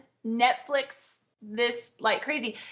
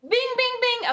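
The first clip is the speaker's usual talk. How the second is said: high sung bing bing like a quiz show bell; correct answer chime; 12 semitones higher than usual. Pretend. Cheering her cohost's Bond pick with a high chime. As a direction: ring the winner bell with your voice.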